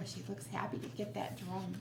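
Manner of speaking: ordinary delivery, nothing marked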